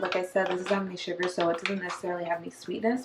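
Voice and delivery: soft, close-mic talking; quiet. Real, not acted. Soft low-volume aside while drinking.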